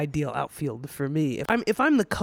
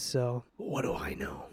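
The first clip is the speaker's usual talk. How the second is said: whispered aside; 13 semitones lower, 10 dB quieter, slower than usual. Real, not acted. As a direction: drop to a near-whisper, a lot softer and slow. Self-deprecating aside after stat rant.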